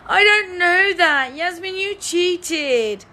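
high, long-drawn mock whine; mock whining. Player accuses Yasmin of cheating, whining. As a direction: imitate a sore loser dragging out every word. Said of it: "moaning and whining".

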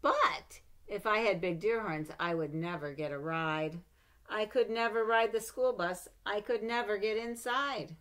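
put-on lively read-aloud for children; story reading. A narrator's reading. Mother reading a picture book to her child, lively.

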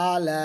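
"আলা" with a very long last vowel; long sustained ending on "আলা"; three-alif elongation. Shown as a demonstration. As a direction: hold "লা" of "আলা" on one level note. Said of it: "তিন দাগ আছে".

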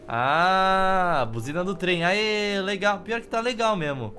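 usual speaking voice

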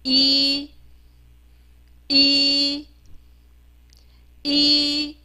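一 as a long, level "ee"; 字母发音示范. Shown as a demonstration. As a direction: hold 一 steady on one pitch. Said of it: "我们念一下". (平稳拉长地念“一”)

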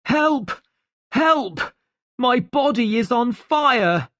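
high-pitched cry for help; urgent, high shout from a man in trouble; shouted alarm. A narrator's reading. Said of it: "the doctor shouted".

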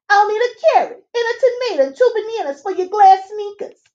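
high, chirpy little fairy man; fairy godfather. Acted. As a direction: squeak it out like a tiny busy fairy. Fairy godfather reads his book.

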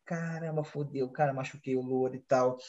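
a low, soft murmur of regret; low, quiet dismay. Retold or imitated. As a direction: mumble it under your breath, guilty. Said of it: "falei assim".

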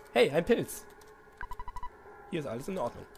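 ordinary delivery

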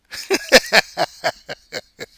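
a real, high burst of laughter; incredulous laugh. Real, not acted. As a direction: laugh at how absurd the rate is. Laughs at absurd interest rate.

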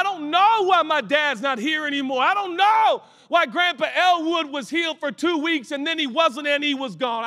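preaching at full force. Acted. Preacher shouting to the congregation about the soul. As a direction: drive the point home to the congregation.

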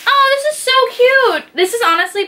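high-pitched gush of delight; thrilled, very high voice; delighted squeal. Real, not acted. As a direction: squeal over something adorable. Delighted reveal of gift.

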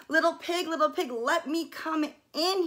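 a hint of a sly wolf at the door; wolf calling. A narrator's reading. Wolf calling to the little pig, lightly voiced. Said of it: "he cried".

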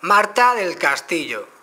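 "Marta del Castillo" said slowly and loudly to the phone; clear loud dictation. Shown as a demonstration. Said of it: "decirlo claro y alto".

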